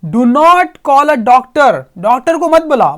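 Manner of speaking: loud, slow exasperated shout at the robot; groaning shout at the robot to stop. Acted. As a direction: yell it, fed up with the robot. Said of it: "एक shout के साथ क्या कह रहा है".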